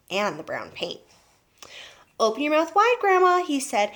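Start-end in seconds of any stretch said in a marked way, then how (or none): 2.2-3.4 put-on higher-pitched eager boy. A narrator's reading. Boy about to dose his grandma, eager. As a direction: call it out brightly, pitched up.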